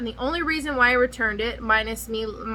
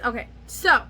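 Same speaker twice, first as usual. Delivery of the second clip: normal talking voice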